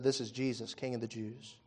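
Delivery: soft, scornful mock title. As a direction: sneer it quietly like a jeering crowd. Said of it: "They mocked him".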